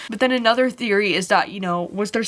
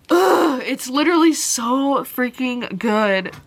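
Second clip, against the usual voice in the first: a loud, high, slow groan of delight, then "so freaking good" stretched out; overwhelmed by love for the book, let it burst out; gushing excitement; 4 semitones higher, 4 dB louder, slower than usual. Real, not acted. Gushing over a book she loved.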